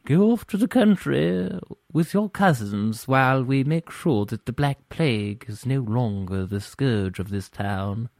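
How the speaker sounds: slow, drawn-out period-novel voice; old Brontë novel character. Acted. Sending family to the country from a plague town, Brontë melodrama. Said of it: "feeling of an old Bronte novel".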